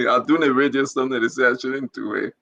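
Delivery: half-laughing, quick and low; laughing it off lightly. Real, not acted. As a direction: chuckle through the words. Laughs at people's advice.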